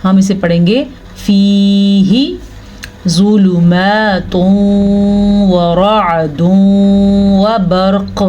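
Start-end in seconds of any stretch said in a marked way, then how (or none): 1.2-2.4 फीही slow, with a clear chest h; clear ha recitation. Shown as a demonstration. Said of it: "हा को clear करके पढ़ेंगे". 3.1-8.2 ज़ुलुमातुन व रअदुन slow, n merged into a long nasal w; recite, humming into each व and bouncing the q of बर्क़; ghunna recitation demo. Shown as a demonstration. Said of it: "मिला भी देंगे और गुन्ना भी".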